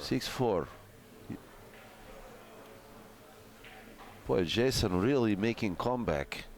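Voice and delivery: put-on broken-English Russian accent; mock Russian commentator voice. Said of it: "commentate this match in a Russian accent".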